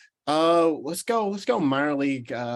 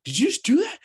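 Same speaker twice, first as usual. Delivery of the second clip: higher, mock furious question; retold livid challenge; 4 dB louder, faster than usual. To best friend Tyler who flicked potatoes, mock furious challenge. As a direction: replay the confrontation, hammed-up anger. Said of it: "I'm so so livid".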